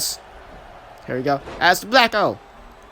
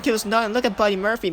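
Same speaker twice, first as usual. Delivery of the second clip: normal talking voice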